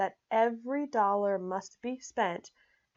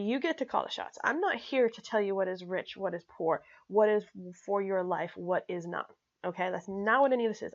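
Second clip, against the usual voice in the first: ordinary delivery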